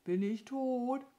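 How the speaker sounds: high, plaintive bird voice; high-pitched, pitiful questioning; pitiful crow. A narrator's reading. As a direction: ask like a dazed little crow. Injured crow asks feebly.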